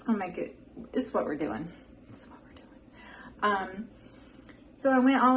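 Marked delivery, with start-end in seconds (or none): 2.1-2.8 whispered aside. Shown as a demonstration. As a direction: repeat it as a soft whisper.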